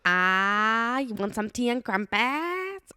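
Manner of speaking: put-on botched British accent.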